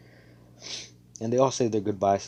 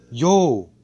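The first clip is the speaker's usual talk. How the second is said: much louder, higher yo; excited yo; 6 semitones higher, 11 dB louder than usual. Retold or imitated. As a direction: shout it out in delight. Squad cheers about stars.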